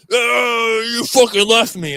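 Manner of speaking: loud, high accusing yell; imitated yelling. Friend rolling in at four in the morning, screaming accusingly. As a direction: wail the accusation like a drunk friend. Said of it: "fucking yelling and fucking screaming at me".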